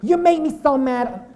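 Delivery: a touch of a sulky American complaint; quoting. Retold or imitated. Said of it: "You know?".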